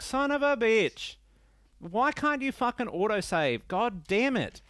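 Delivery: high, drawn-out frustrated cursing; acted exasperation. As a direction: replay the outburst of a player who lost progress. Acting out discovering the game didn't save.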